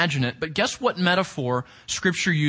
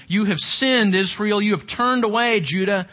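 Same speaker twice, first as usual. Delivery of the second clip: fiery prophetic proclamation; 4 semitones higher than usual. Acted. Proclaims Isaiah's indictment.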